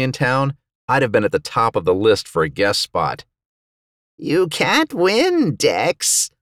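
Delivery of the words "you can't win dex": slow, high, drawn-out djinn voice; taunting djinn. A narrator's reading. Djinn to Dex as he fights, slow taunting. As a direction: stretch the words out high and eerily. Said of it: "I heard the djinn say".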